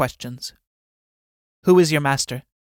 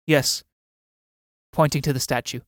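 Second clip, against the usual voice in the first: ordinary delivery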